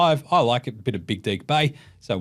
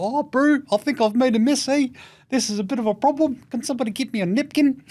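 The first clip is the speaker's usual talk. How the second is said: high-pitched mock Kiwi voice; Kiwi accent; 10 semitones higher than usual. Sick player asks for a napkin, in a high mock Kiwi accent. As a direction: put on a high New Zealand accent. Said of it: "try to say it in a Kiwi accent".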